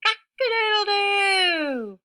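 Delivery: very high, loud crowing cock-a-doodle-doo; rooster crow. Acted. Teacher crowing as Rooster for the kids, loud and high. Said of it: "crowed Rooster".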